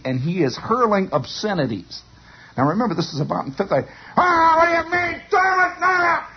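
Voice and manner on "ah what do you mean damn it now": imitated furious kid's yell, pitched way up. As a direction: holler like a raging fifth-grader. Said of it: "He's yelling like that".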